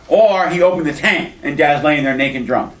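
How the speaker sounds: normal talking voice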